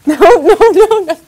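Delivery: high, loud, laughing protest; squealing no. Real, not acted. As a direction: shriek it through laughter. Laughing protest at cake smash.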